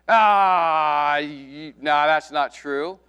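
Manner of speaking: loud drawn-out dismissive "Ah"; mocking scoffer. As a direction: scoff and wave it away. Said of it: "They're called mockers".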